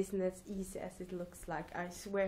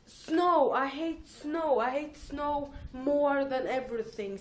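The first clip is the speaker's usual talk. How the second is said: loud, higher rant about snow; hating snow; 5 semitones higher, 6 dB louder, slower than usual. Real, not acted. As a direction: vent your hatred of snow.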